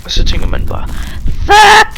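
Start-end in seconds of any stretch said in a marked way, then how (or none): 1.5-2.0 high, loud startled yell; sudden shouted curse. Retold or imitated. Player acts out his fright, a sudden high loud curse. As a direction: blurt it like a fright.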